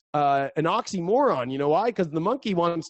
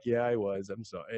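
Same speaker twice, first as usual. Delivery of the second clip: sheepish apology; 6 semitones lower, 8 dB quieter than usual. Retold or imitated. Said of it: "And you were like".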